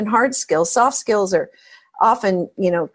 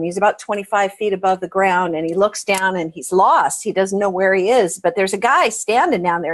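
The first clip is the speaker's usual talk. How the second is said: usual speaking voice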